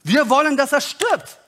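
high, emphatic group demand; insistent crowd demand. Acted. Crowd answering Pilate about Jesus, insistent and high-pitched. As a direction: call for the death in high, weighted words.

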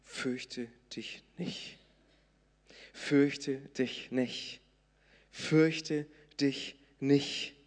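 very slow, soft comforting words; calm a frightened father; slow tender voice of Jesus; gentle reassurance. Acted. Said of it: "indem Jesus sagt zu ihm".

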